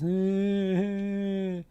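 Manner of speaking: high, loud mock whine, drawn out; long whiny fake-cry groan. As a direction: imitate a kid's badly faked cry. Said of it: "It was a really fake, fake cry".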